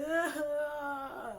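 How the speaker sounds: high, loud crying wail. Real, not acted. Answering the study group texts, a high crying wail. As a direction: let out a wail through tears.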